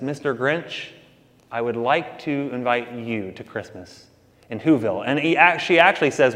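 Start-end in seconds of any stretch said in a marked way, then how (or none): 0.0-5.0 put-on soft, sweet little-girl invitation; sweet and gentle. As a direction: invite him kindly and sweetly, much softer. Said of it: "in her sweetness and says".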